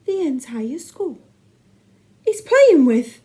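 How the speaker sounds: slow start, climbing high and loud; appalled, reluctant. A narrator's reading. Appalled teacher who cannot say the word, spluttering upward. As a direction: break off before the unspeakable word. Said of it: "he could barely bring himself to say".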